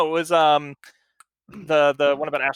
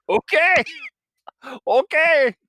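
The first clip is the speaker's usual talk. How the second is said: excited; 10 semitones higher, 5 dB louder, slower than usual. Retold or imitated. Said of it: "She's like".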